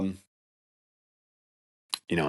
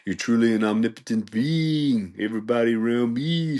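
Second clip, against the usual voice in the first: higher, mystical put-on voice; mock channeler voice; 4 semitones higher, 3 dB louder than usual. Vlogger mocking the channeler lady, higher mock-mystic intoning. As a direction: intone like a spirit medium. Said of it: "That's how this lady sounds".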